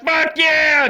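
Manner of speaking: high, loud whooping shout; loud high party yell; excited cheer. Retold or imitated. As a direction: yell it like you just joined the party. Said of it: "And I was like".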